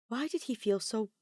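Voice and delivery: ordinary delivery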